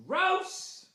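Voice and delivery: high disgusted yelp; disgusted shriek. Real, not acted. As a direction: squeal it out in disgust. Disgusted reaction to letter.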